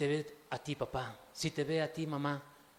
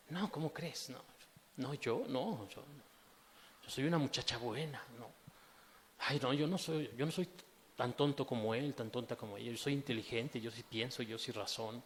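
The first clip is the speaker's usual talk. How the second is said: softly voiced smug churchgoer; a hint of a prim good girl defending herself; self-righteous listener; 5 semitones lower, 6 dB quieter than usual. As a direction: play the one who thinks they are above falling. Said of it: "voicing a self-righteous listener".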